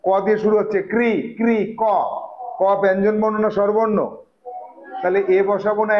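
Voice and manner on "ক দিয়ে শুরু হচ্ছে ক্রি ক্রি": ordinary talk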